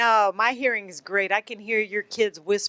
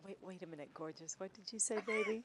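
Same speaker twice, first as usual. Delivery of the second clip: hushed; 17 dB quieter, faster than usual. Retold or imitated. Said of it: "Say it again".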